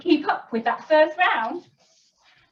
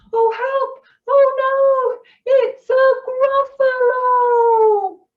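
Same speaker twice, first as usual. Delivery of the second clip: much higher and louder, the last words slow; mock fright; 12 semitones higher, 6 dB louder, slower than usual. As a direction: gasp of storybook terror. Mouse sees Gruffalo, alarmed.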